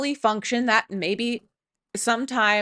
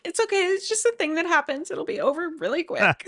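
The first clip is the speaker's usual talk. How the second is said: reassuring; 7 semitones higher, faster than usual. Retold or imitated. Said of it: "I'm like".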